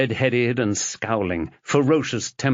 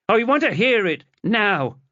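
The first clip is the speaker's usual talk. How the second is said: insistent demand; 12 semitones higher, 3 dB louder than usual. Acted. Chloe insists on hearing news.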